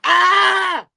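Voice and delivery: loud, high hammed-up dying cry; mock death scream. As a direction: scream like a badly acted dying monster. Acting out dragon's death scene.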